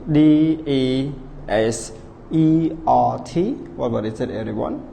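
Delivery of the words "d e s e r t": D E S E R T spelled letter by letter, slow; spelling aloud. Shown as a demonstration. Teacher slowly spelling D E S E R T for the class. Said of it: "read the word".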